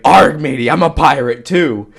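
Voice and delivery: loud hammy pirate growl. Retold or imitated. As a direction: play a cheesy pirate. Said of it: "he's like".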